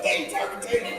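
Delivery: breathy high whispered disbelief; mock disbelief, whispered up high; shocked whisper. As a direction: hiss it in amazement. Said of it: "I said".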